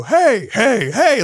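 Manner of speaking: high shouted hype yells, rising. Retold or imitated. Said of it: "I'd do it over and I'd go".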